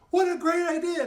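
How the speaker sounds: very high, loud exclamation; excited inspiration. Acted. Jared admires his friend's trick, excited and loud. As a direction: cry out, thrilled by the idea.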